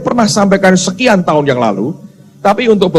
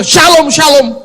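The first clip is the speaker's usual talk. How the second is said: higher, mildly irritated mimic; annoyed mock greeting; 10 semitones higher, 3 dB louder than usual. Man tired of waiting mocks the angel's greeting, annoyed. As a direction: echo the greeting with impatience.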